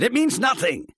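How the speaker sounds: loud high shout, stress on means. A narrator's reading. Husband shouting at his wife to drop it. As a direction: hammer it out at her. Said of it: "he shouted".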